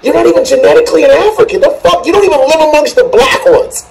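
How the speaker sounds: high, pressed and fast; incredulous anger at the claim; real worked-up outrage; indignant rant. Real, not acted.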